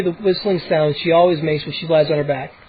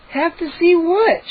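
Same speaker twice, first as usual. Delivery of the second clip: groan; 11 semitones higher, slower than usual. A narrator's reading. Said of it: "she groaned".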